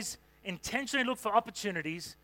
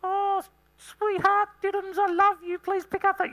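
put-on much higher, louder, slow sugary baby talk; nice cute voice; 11 semitones higher, 6 dB louder, slower than usual. Shown as a demonstration. Pastor sweet-talking his wife to pick things up, high cutesy coo. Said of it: "nice, cute voice like that".

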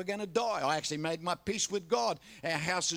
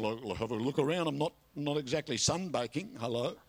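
low-pitched, deadpan retort; dry sarcasm; 5 semitones lower than usual. Retold or imitated. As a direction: point out the obvious with weary irony. Sarcastic answer to God, retold.

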